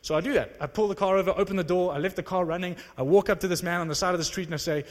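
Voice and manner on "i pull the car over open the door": usual speaking voice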